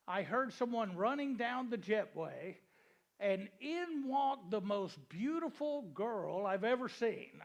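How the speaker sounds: ordinary talk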